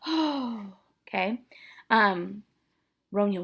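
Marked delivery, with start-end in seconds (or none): 0.1-0.7 demonstrated dramatic sigh. Shown as a demonstration. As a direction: let out a long sighed "oh". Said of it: "an O like a sigh".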